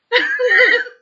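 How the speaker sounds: high loud laughing shriek; burst of laughter. Real, not acted. Laughing at her own innuendo.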